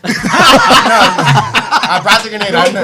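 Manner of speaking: words bubbling through real laughter; laughing while talking. Real, not acted. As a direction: laugh your way through the denial. Laughing at the grenade joke.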